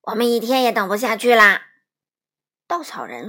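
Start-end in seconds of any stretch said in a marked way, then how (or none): 0.0-1.7 loud, exasperated complaint; impatient protest. A narrator's reading. Scarecrow refusing to wait for the wizard, loud and impatient. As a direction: push it out loudly, out of patience.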